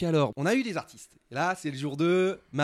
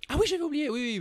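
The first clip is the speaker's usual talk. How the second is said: sudden recall; faster than usual. Real, not acted. Suddenly remembers the screens.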